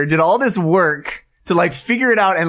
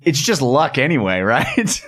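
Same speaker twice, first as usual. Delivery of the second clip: usual speaking voice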